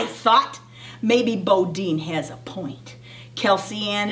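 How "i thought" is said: usual speaking voice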